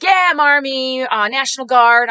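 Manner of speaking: hearty soldier. Retold or imitated. Army man answering the candidate's thanks, hearty. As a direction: stretch it out slowly and heartily.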